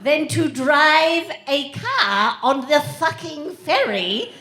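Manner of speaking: high, stretched-out comic outrage; slow it down and hammer the swearword; exasperated rant. Acted. Comic complaining on the Isle of Wight, exasperated.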